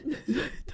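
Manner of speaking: a soft, airless giggle; breathless giggle. Real, not acted. Laughing at her own dream story, soft airless giggle. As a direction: giggle under your breath.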